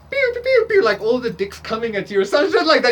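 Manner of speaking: high, loud playful pew pew sounds; mock shooting noises. Reader telling the viewer suitors come at them, mock pew pew sounds. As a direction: fire off cartoon laser noises. Mimicking shots fired at viewer.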